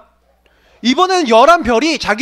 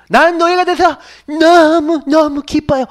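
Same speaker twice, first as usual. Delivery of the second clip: a sweet, slow, higher mock-joyful voice; hammed-up delight at being sold; mock happy slave; 4 semitones higher, slower than usual. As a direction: play the absurdly cheerful slave. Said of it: "이런 친구 있어요".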